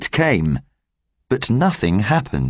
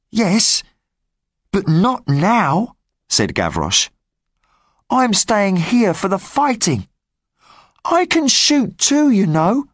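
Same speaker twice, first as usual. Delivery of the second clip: put-on high-pitched cheeky boy's voice; street boy Gavroche; 10 semitones higher than usual. Gavroche warning the rebels about the spy, high boyish voice. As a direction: talk up high like a scrappy street kid.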